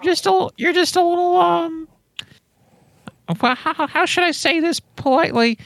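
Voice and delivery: high, hesitant let-down; high voice, fumbling for polite words; Tim Spooky. Acted. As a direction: hedge it in a put-on high voice. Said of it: "Tim Spooky's like".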